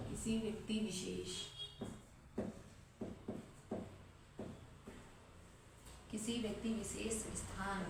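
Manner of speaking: slow, broken-up dictation, pausing to write. Acted. Teacher dictating a definition to students, slow and soft. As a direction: spell it out word by word for students copying.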